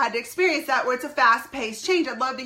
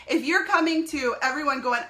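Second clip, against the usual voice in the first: ordinary talk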